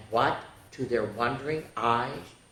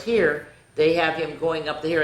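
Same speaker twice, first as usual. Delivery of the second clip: usual speaking voice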